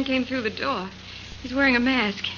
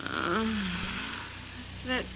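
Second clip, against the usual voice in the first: dazed and weak; 8 dB quieter, slower than usual. Acted. Patsy just knocked out, dazed.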